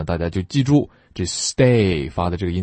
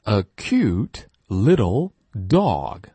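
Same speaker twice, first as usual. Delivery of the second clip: slow a cute little dog, falling on dog; slow read-along; much slower than usual. Shown as a demonstration. Teacher leading a slow read-along. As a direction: read it slowly with the class. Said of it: "下面一起大声朗读".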